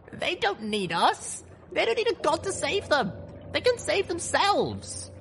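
put-on high, fast determined young mare; young heroine voice. A narrator's reading. Voiced heroine Little Pip.

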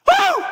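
high, loud whoop of delight; a high gleeful woo; joyful whoop. Real, not acted. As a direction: let out a happy holler. Joyful whoop after hymn line.

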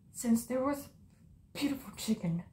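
hushed storytelling whisper; whispered story. Acted. Chica whispering the truth to Foxy. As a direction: lean in and whisper the tale. Starting to tell the secret.

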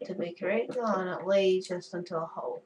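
usual speaking voice

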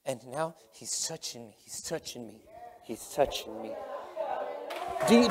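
ordinary talk, nothing marked

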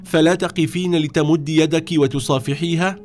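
high-pitched, mildly angry questioning; indignant rebuke. A narrator's reading. Mother rebukes Fatima for staying seated, indignant.

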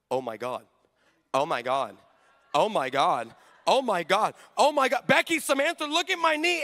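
mock amazed cheerleader, building from murmured disbelief to excited cries; mounting amazement.

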